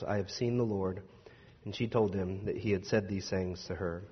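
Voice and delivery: low, soft, measured Bible reading; scripture reading. Acted. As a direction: read the gospel calmly and reverently. Scripture reading before sermon.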